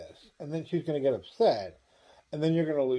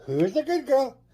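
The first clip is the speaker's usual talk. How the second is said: put-on pitched-up baby talk to a dog; high pet-talk; 10 semitones higher, 4 dB louder than usual. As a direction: coo it high and sing-song at the dog. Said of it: "Let's do line number Q".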